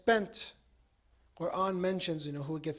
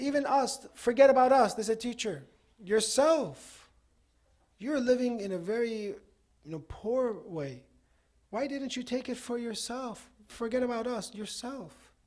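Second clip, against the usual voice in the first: raised, emphatic retold plea; urgent appeal; 6 semitones higher, 6 dB louder than usual. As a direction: press the teacher with higher-pitched insistence. Students confront their teacher in retold story.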